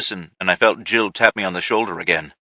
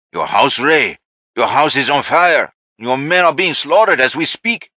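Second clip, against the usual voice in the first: taunting cartel man; 6 semitones higher, 3 dB louder than usual. Acted.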